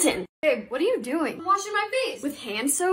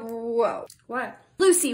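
normal talking voice

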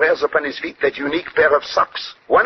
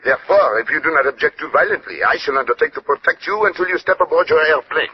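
French-tinged English of a Belgian detective; courteous Belgian French accent. Acted.

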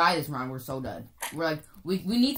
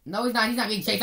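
ordinary talk

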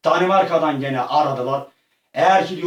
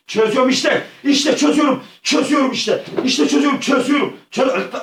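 exasperated yelling; 9 semitones higher, 4 dB louder than usual. Real, not acted. Frustrated struggle in game.

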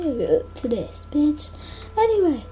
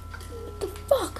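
stunned whisper; 6 semitones higher, 9 dB quieter, slower than usual. Real, not acted. Stunned by mob in game.

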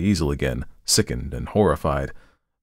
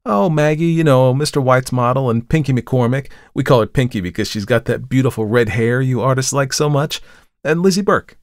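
pitched-up, breezy gossip; chattering; 6 semitones higher, 4 dB louder than usual. A narrator's reading. Model lists her companions to the artist, chattering. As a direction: rattle off the friends' names in a high voice. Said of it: "she began to chatter".

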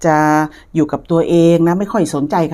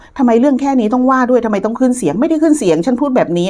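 higher-pitched mock protest; acted bickering; 7 semitones higher than usual. Partners arguing over who raised their voice, acted bickering. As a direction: act out a petty lovers' quarrel, pitched up. Acts out a couple's petty quarrel.

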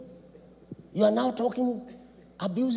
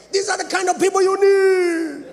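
high, loud shouted point; preaching shout; 11 semitones higher, 8 dB louder than usual. Real, not acted. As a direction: belt it out to the congregation.

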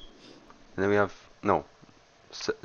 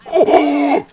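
high sudden cry of fright; startled yell; 12 dB louder than usual. Real, not acted. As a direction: jump out of your skin. Monster appears.

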